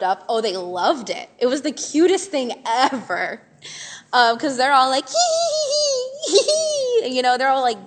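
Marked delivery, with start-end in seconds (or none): 5.1-7.1 high, squealy mock giggle; hammed-up childish tee-hee; imitating giggling kids. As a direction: giggle like a delighted little kid.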